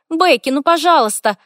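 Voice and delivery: high, loud eager pleading; begging. A narrator's reading. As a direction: beg with childlike insistence. Sister pleading.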